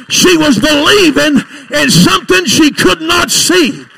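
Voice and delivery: very loud, drawn-out preacher's cry; booming revival exhortation; full-force preaching. Real, not acted. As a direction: preach it hard to the whole church.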